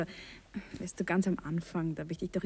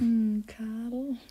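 tender whisper; 3 dB louder than usual. Acted. Wife tender in husband's arms.

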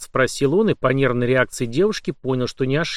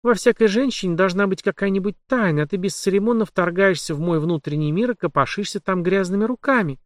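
higher, lightly voiced heroine; young woman voice; 7 semitones higher than usual. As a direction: voice the journalist, higher.

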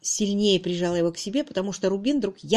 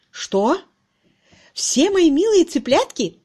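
loud, high, outraged question; thunderous outcry; 8 semitones higher, 6 dB louder, slower than usual. A narrator's reading. Master learning of the shaken bottles, roaring. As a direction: explode in horrified disbelief. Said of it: "Вопль превратился в гром".

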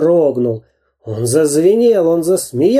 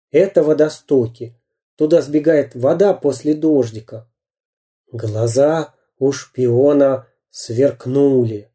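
usual speaking voice

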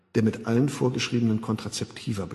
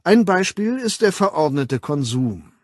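emphatic lecturer addressing an audience; dozierender Weltaufsichtsrat; 7 semitones higher, 7 dB louder than usual. Acted. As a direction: hold forth like a public official. Weltaufsichtsrat doziert vor Zuhörern. (dozierend, nachdrücklich)

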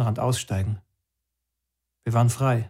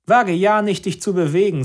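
much higher and louder threat; threatening order; 7 semitones higher, 5 dB louder than usual. Acted. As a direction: warn him off menacingly. Policeman threatens.

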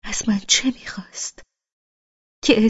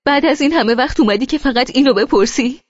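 higher and louder, bitter accusation; angry shout; 6 semitones higher, 8 dB louder, faster than usual. Acted. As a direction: throw it in his face. Shouting back in quarrel.

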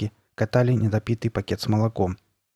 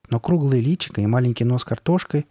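ordinary delivery

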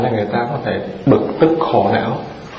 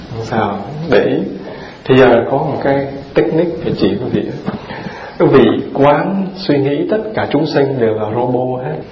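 usual speaking voice

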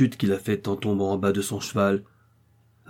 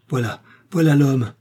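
high, loud cry of recognition; startled, raised accusation; accusing cry; 4 semitones higher, 5 dB louder than usual. A narrator's reading. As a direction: cry out on spotting the attacker. Wounded man points out his attacker.

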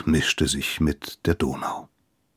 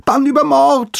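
high, loud shriek of horror; horrified scream; 6 dB louder than usual. A narrator's reading. Giselher screams as the priest falls overboard, horrified shriek. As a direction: scream it out in panic. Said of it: "schrie Giselher entsetzt auf".

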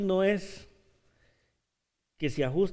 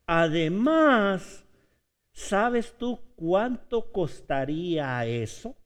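very slow, drawn-out, louder objection; slow reasoning; 5 dB louder, much slower than usual. Acted. Disciple slowly explaining to Jesus why it cannot work. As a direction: spell out each step slowly.

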